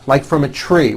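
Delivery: ordinary talk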